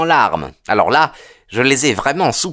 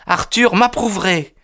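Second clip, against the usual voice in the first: put-on higher young woman's voice, resolute; firm; 8 semitones higher than usual. A narrator's reading. Daughter answering her mother's accusation, firmly. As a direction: answer firmly, without wavering. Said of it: "d'un ton ferme".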